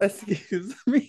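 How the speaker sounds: drawn-out, high excuse me; high-pitched, slow disbelief; scandalized. Real, not acted. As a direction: stretch it out in mock shock. Mock shock at co-host's gushing.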